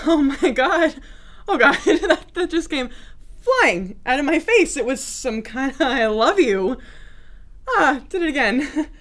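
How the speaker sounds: mortified at your own slip, laughing through it; flustered blurting. Acted. Confessing love by accident to an ex, flustered and laughing.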